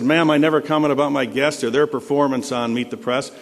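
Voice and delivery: ordinary delivery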